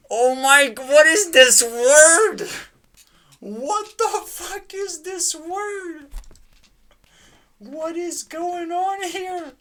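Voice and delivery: high, loud, slow disbelieving cry; incredulous yell, drawn out; baffled shout. Real, not acted. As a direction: wail it at the absurd word. Baffled by the vocabulary.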